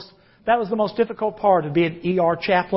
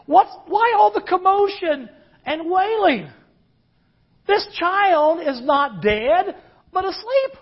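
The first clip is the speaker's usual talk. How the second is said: exclaiming; 7 semitones higher than usual. Retold or imitated. Jesus rebuking the wailing crowd.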